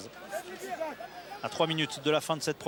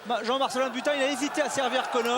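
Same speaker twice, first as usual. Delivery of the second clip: esports caster; 7 semitones higher than usual. Real, not acted. Live rugby break, commentator erupts.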